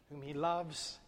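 ordinary talk

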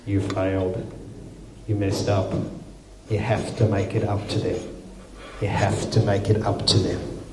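low, soft accusing inner voice; nagging inner voice. Retold or imitated. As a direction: murmur it like the guilt inside your head. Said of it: "that little voice inside going".

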